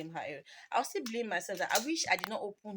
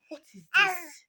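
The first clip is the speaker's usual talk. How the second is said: shocked outcry; 9 semitones higher, 11 dB louder than usual. Retold or imitated. Mother sees the blood.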